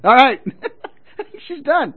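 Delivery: ordinary talk, nothing marked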